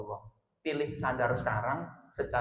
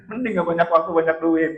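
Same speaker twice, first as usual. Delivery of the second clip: mock wifely grumble, loud and raised; complaining; 4 semitones higher, 11 dB louder than usual. Wife to husband about time and money, mock complaining. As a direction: pile up grievances like an imitated nagging wife. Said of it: "Tadinya ngeluh".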